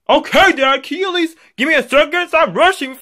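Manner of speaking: high-pitched bratty protest; exasperated kid. Acted. Kid being hurried along by dad, exasperated. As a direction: complain high, like a rushed child.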